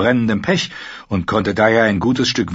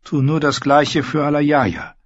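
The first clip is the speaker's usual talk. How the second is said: bitter thought; 5 semitones higher than usual. Acted. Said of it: "dachte er verbittert".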